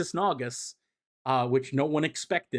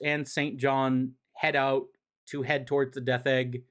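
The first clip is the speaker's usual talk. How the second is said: normal talking voice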